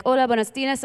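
lightly voiced Spanish-speaking girl; Spanish greeting. Girl in the driveway greeting in Spanish, lightly voiced. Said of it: "this girl came up".

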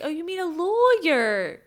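high, slow, drawn-out question; imitated teacher. Teacher answering the boy about his dad, imitated question. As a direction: stretch it up high as the teacher's correction. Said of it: "And she's like".

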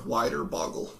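ordinary talk, nothing marked

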